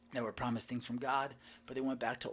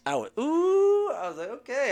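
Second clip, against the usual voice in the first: high, drawn-out thrilled ooh; can't contain the joy; overjoyed ooh; 9 dB louder than usual. Real, not acted. Reacting to the stranger's prophecy, overjoyed.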